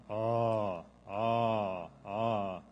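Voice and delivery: loud drawn-out zombie "aw" moan; long open zombie groans; zombie caw. Shown as a demonstration. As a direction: groan the gull call as a zombie. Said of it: "And from zombies".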